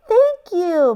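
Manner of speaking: high, delighted thank-you; beaming thanks. A narrator's reading. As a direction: beam as you say it. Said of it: "Missus Peacock beamed".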